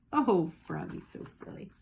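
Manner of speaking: whispered aside. Real, not acted. Aside to children.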